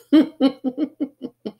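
loud high laugh; amused laugh. Real, not acted. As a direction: burst out laughing. Laughs at double gift.

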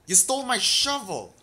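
loud, high outraged cry; outraged shout. Real, not acted. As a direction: yell it in disbelief. Goblin stole shovel.

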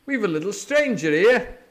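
put-on high, loud, jovial old sea cook; hearty pirate patter; beaming Silver. A narrator's reading. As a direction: chatter on with false cheer. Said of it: "he continued".

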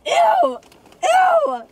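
high, loud grossed-out squeal; disgusted shriek. Real, not acted.

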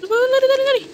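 high loud frantic yelling; panicked scream. Real, not acted. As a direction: scream to run away. Fleeing in panic.

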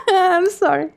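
high-pitched giggling apology; helpless laughter. Real, not acted. Player apologising after screaming, helpless laughter. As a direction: laugh right through the apology.

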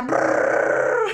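loud high trilled drill noise; mouth-made power drill racket; imitated drill. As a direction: buzz like a drill with a rolling trill. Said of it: "Hola, vecina taladra".

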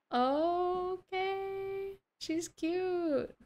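high, drawn-out squeaky coo; delighted coo. Real, not acted. Delighted by game item.

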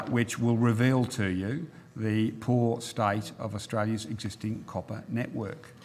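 ordinary talk

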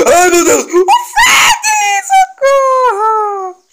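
high shrieking cry for help; panicked screaming. Real, not acted. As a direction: scream half laughing in panic. Attacked in game, screams for help.

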